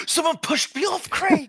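high, loud gasping shout; being chased. Real, not acted. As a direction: yelp in outrage. Pushed off in game.

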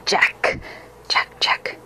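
whispered, hissing name; ghostly hiss. Acted. Ghost hisses at the hunter from the swamp, whispering. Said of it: "it hissed".

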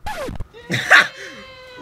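sudden high burst of laughter; shocked laughter. Real, not acted. Reacting to pregnancy reveal in clip.

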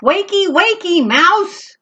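high, loud bouncing call; kitten yelling. Acted. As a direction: yell it while bouncing. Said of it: "yells Max".

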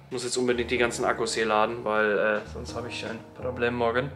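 ordinary talk

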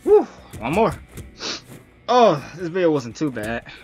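ordinary delivery

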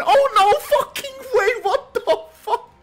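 high, loud shout of disbelief; can't believe the kill just happened; stunned shout. Real, not acted.

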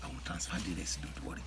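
hushed whisper. Acted. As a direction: keep it secret, whisper. Secret money talk.

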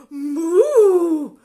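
loud crying moo; cow moo. Acted. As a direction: bellow it like a cow. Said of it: "And the cow said".

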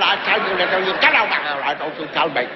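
a hint of a finger-wagging scolding wife. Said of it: "she shook her finger like this one".

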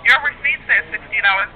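ordinary delivery

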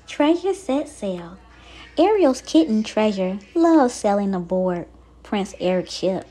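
ordinary delivery

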